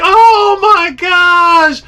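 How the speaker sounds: high, loud and slow; amazed shout. Retold or imitated. Angler boating his personal best, amazed. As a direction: draw it out in amazement. Fish lands in boat.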